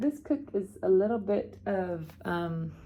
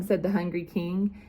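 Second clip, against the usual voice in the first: ordinary delivery, nothing marked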